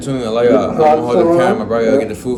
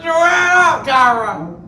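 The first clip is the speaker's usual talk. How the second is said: much higher, slow call across the room; slower than usual. Real, not acted. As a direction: call her to get up. Calling out to Kyra.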